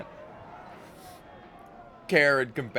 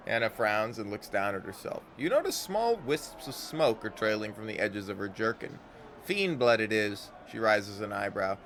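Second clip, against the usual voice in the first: usual speaking voice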